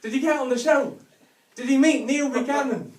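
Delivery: put-on high, loud, eager kid; excited child. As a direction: ask it like a thrilled boy. Excited child asking again.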